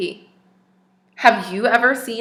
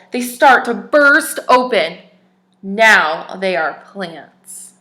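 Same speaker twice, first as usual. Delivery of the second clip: clear, even storybook reading voice; story narration; 7 dB louder, slower than usual. Acted. Teacher reads a picture book to children, storybook narration. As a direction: read the tale aloud to little listeners.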